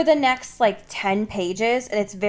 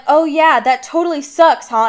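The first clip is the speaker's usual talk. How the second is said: louder, higher sarcastic jab; loud cocky taunt; 6 semitones higher, 7 dB louder than usual. Retold or imitated. Narrator voices Bella mocking Felix, loud and sarcastic. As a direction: throw it back at him, loud and cocky. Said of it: "Bella's like".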